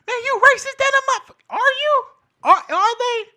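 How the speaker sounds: very high, loud squawk of offense; high-pitched frantic overreaction; mock outrage. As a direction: burst out in hammed-up indignation. Acts out offended reply to compliment.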